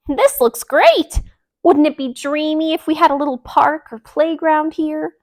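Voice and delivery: high, loud and gushing; a delighted duck swooning over her work; loud, high, dreamy delight; excited duck. Acted. Delilah's excited line.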